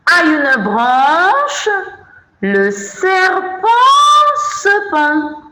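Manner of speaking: high, slow singsong verse; singsong recitation. Shown as a demonstration. Teacher reciting the snake verse to children, high singsong. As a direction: lift the poem line high and stretch it out.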